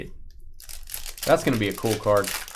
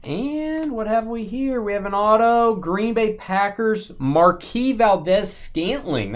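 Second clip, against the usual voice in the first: high, drawn-out announcement of a find; excited card reveal; 9 semitones higher, slower than usual. Real, not acted. Host revealing an auto card to viewers, excited announcement. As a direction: build it up slowly, pitched high.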